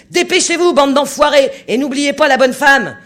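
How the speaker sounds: hurlement d'ordre, très haut et très fort; ordre hurlé. A narrator's reading. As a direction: appelle tout l'équipage à pleine voix. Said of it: "hurla-t-il". (le capitaine hurle l'ordre à l'équipage)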